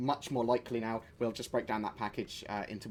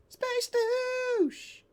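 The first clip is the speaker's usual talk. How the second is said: mock high squeal; 4 dB louder than usual. Taunting a player who blew ship.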